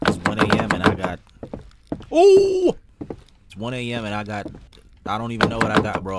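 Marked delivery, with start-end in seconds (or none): 2.1-2.8 sudden shrill yelp; startled high yelp. Real, not acted.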